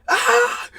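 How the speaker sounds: sudden high scream; loud, high yelp of imitated terror; short hammed-up shriek; mock scream. Imitates Renfield's scream at the bat.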